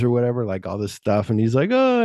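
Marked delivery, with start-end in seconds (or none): 1.7-2.0 high startled oh, much higher and louder; squeaky scared oh; fake frightened gasp. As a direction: yelp in mock fear. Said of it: "he's acting afraid".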